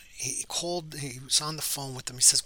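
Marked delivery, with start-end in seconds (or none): none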